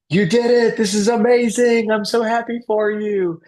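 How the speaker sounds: high, loud squeal of congratulations; excited cheering. Acted. Family rushing from the stands to congratulate, excited cheering. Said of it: "coming down from the stands to be like".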